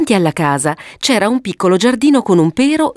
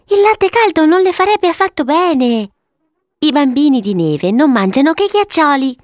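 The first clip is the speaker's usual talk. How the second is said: put-on high, know-it-all child voice; wise little girl; 9 semitones higher than usual. Violetta teaching her brother about snow children, sagely. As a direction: explain like a sensible big sister. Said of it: "diceva saggiamente Violetta".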